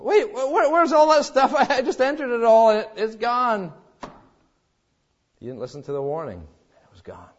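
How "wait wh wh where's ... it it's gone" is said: panicked surprise. Retold or imitated. Panic over a lost computer file.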